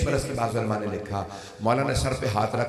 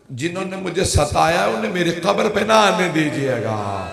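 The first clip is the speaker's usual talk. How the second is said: higher and louder pleading; a touch of raised lament; raised preaching; 6 semitones higher, 4 dB louder than usual. Acted. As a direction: lift the voice on the last wish. Climax of the will quote.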